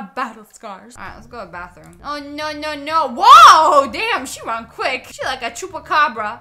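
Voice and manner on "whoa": loud surprised yelp; startled whoa. Real, not acted. Player yelps as the girl runs off, loud surprise. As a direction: blurt it out in shock.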